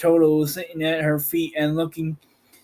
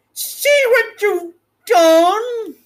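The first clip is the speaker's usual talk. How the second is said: high, slow and louder, shrieking; witch's scream; 6 dB louder, much slower than usual. Acted. The melting witch screams at Dorothy in horror. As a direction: shriek it in horror at what she did. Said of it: "she screamed".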